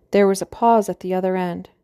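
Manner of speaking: plain reading voice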